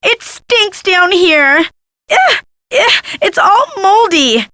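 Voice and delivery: high, drawn-out whine of disgust; disgusted whining. Acted. Thief stuck somewhere moldy, high disgusted whine.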